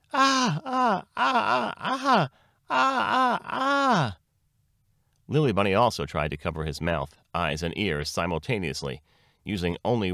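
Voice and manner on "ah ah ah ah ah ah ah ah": high-pitched wailing "ah" cries; very loud shouting. A narrator's reading. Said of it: "started to shout very loudly".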